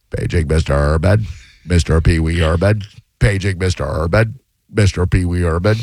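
low paging-announcement voice imitating Pee Wee as clerk; hotel clerk paging. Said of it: "instead of talking like Pee Wee, he has to page Pee Wee Herman".

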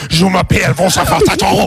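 hammed-up French, sing-song; mock French accent.

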